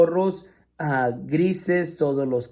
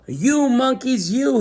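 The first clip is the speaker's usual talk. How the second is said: high, loud scolding of the monkeys; scolding peddler; 9 semitones higher, 4 dB louder, slower than usual. A narrator's reading. Peddler scolding the monkeys, high and loud. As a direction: wag a finger and scold them. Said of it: "he said, shaking his finger at them".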